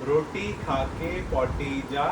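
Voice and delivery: very slow, word by word; slow articulation. Real, not acted. Father gives child a phrase to lip-read, slowly. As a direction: spell it out slowly for a lip-reader.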